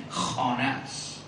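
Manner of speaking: usual speaking voice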